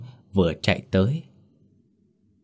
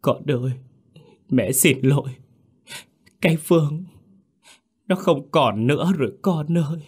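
high sobbing mother's voice; khóc nức nở; 7 semitones higher than usual. A narrator's reading. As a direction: wail the confession through tears. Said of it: "khóc nức nở". (nghẹn ngào khóc, giọng cao)

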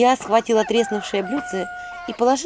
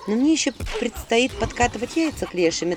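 ordinary delivery

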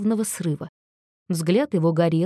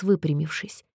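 usual speaking voice

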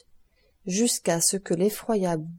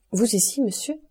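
startled question; 4 semitones higher than usual. A narrator's reading. Villefort startled to find the abbé, sharply rising. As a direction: voice jumps up on monsieur.

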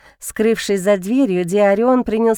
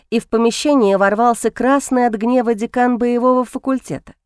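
ordinary delivery

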